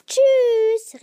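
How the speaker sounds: high, very loud drawn-out farewell; shouted goodbye. A narrator's reading. Three friends answering the goodbye, high loud call. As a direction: shout it back across the distance. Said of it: "riefen die drei".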